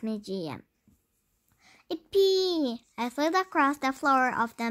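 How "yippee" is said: excited cheer. Acted.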